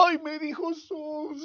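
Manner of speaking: high, drawn-out fake wail; soft, high, hammed-up sobbing; mock crying. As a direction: whimper it slowly like a crybaby. Mimics a woman crying over insult.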